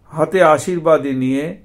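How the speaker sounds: measured, recitative storytelling read; scripture reading. A narrator's reading. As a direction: recite the Bhagavatam passage with devotion. Scripture reading after plain intro. (ভক্তিভরে শাস্ত্রপাঠ)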